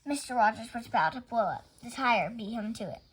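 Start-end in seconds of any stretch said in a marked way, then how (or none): none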